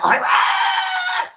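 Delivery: high ringing stage shout of all right; shouted greeting. Retold or imitated. Singer greeting the crowd, high excited shout. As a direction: belt it out to the arena.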